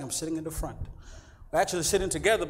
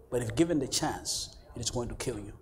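grave warning; 6 semitones lower, 6 dB quieter than usual. Acted. Zookeeper warning a visitor about the lion, grave warning. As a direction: let it drop lower, with ominous pauses.

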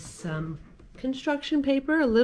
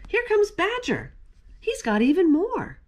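excited hare voice; 10 semitones higher than usual. Acted. Hare announces Badger.

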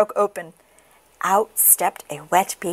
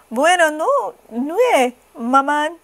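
high little French duckling; baby duck; 8 semitones higher, slower than usual. A narrator's reading. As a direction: say good night high, as a duckling. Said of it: "said the baby duck".

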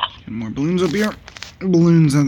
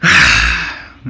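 long heavy sigh; 12 semitones lower, 4 dB louder than usual. Real, not acted. Disappointed after losing game.

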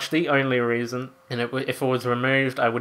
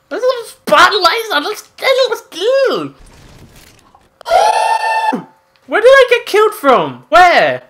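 excited; 10 dB louder than usual. Real, not acted. Excited grabbing weapon, then killed.

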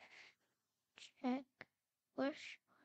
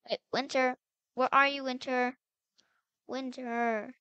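loud call for someone missing; raised, searching call; 6 dB louder than usual. Pretend. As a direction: call out for a lost sister. Searching and calling for lost sister.

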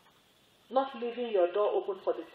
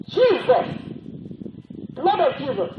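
high, loud cry of Jesus; retold shout; 4 semitones higher, 4 dB louder than usual. Woman shouting at an intruder in her room, loud cry. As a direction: cry out the name in alarm. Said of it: "And she shouted".

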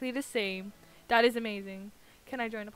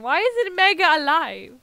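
exasperated shout; 5 semitones higher, 12 dB louder than usual. Real, not acted. Loud exasperated question.

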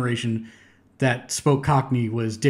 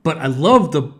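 ordinary talk

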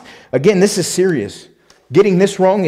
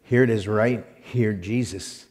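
ordinary delivery

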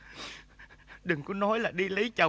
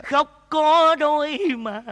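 hammed-up weepy cry, higher, louder, slow; mock crying; 8 semitones higher, 8 dB louder, slower than usual. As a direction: overdo the sobbing line.

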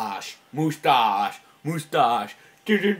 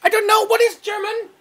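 very high, loud conehead; 5 dB louder than usual. Pretend. Baffled by the question about German, conehead voice. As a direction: answer in a piercingly high alien voice. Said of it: "I sound like a conehead".

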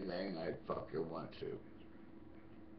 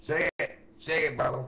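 loud, higher gospel shout; call and response; 9 semitones higher, 8 dB louder than usual. Pretend. Urging himself on mid-song, loud gospel call. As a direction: shout it like a revival.

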